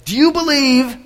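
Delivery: loud, high showman's challenge; daredevil addressing crowd. Acted. Daredevil with a blindfold asks the crowd, loud showman's call. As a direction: throw the dare out to the whole crowd. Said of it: "goes to the crowd".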